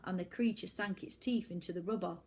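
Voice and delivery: usual speaking voice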